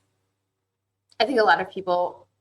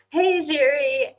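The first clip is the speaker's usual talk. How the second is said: drawn-out cheerful call, higher and louder; slow bright hail to a friend; calling out hello; 8 semitones higher, 5 dB louder, slower than usual. Real, not acted. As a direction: sing out the greeting across the room. Calling out a greeting to viewer.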